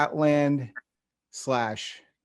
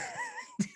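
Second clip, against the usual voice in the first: squealing laugh of embarrassment; high embarrassed laugh; 12 dB quieter than usual. Real, not acted. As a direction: burst out in a high cringing laugh. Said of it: "most embarrassing thing to tell you".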